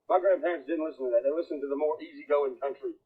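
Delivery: ordinary delivery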